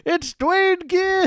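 high, slow, drawn-out ring announcer call; mock announcer. Podcaster hamming up a wrestler's entrance, slow high announcer call.

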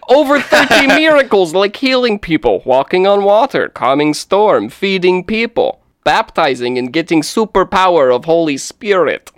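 hammed-up bad Russian accent. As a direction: read it in a terrible Russian accent. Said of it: "terrible Russian accent".

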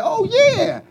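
high, loud, smug agreement; vain king. Retold or imitated. Vain king accepting flattery.

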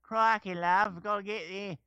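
high-pitched, garbled fake Aussie; mock Australian falling apart; attempted Australian accent. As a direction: fumble through a bad Australian accent. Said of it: "let me hear your Australian again".

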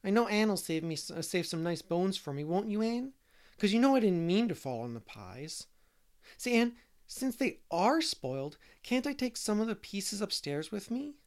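put-on high-pitched little boy, coaxing; wheedling small boy Davy. A narrator's reading. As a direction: wheedle for a treat in a child's high voice.

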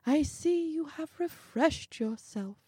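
high-pitched fussy visitor; fussy little man's lofty compliment; effusive little man. Acted. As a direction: greet him with airy, high politeness.

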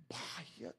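breathy whisper; whispered aside. Real, not acted. Pastor confiding to the church, whispering. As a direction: drop to a whisper.

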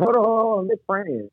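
high-pitched mock usher halting someone; retold fussy church usher; officious usher. Usher blocks him at the door.

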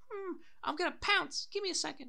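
tiny, very high sneaky voice; cat about to pounce. Retold or imitated. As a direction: squeak it playfully, like a plotting cat. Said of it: "Like a cat".

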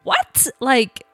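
squeaky, very high astonished what; stunned disbelief. Retold or imitated. Wife hears she is negative, squeaks in disbelief. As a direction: disbelief at news that makes no sense.

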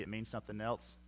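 ordinary talk

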